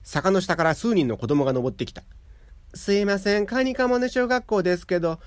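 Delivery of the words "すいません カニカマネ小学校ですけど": put-on polite child voice. A narrator's reading.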